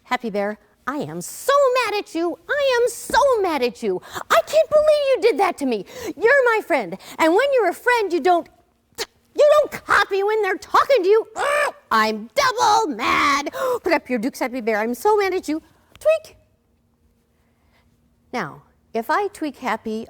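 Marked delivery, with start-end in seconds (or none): 0.0-15.6 mock angry rant, much higher and louder, building up; hammed-up fury at a friend who copied you; teacher playing a bad touch to the puppet, hammed-up angry scolding; pretend anger. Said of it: "you might do something like this". 16.0-16.4 squeaky tweak. Acted. Tweaking the bear's nose.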